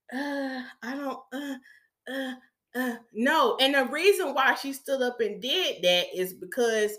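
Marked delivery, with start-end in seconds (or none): 0.1-3.0 soft, halting hesitation; hesitant, softened mumbling with broken pauses; unconfident dithering. Retold or imitated. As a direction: imitate someone too unsure to answer. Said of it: "you didn't have no confidence in yourself".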